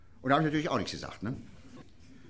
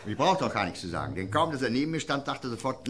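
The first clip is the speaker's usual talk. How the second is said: ordinary talk, nothing marked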